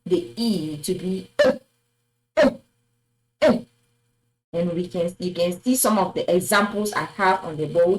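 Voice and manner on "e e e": E as French é, short and separated; acute é sound. Shown as a demonstration. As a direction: repeat E as a crisp 'ay'. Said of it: "The acute accent makes".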